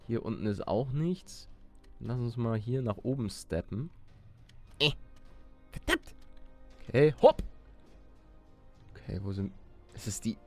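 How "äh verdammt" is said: ordinary delivery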